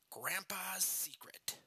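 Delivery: breathy spooky whisper. Acted. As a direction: whisper the title ominously. Whispered episode title.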